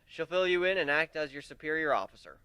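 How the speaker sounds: plain reading voice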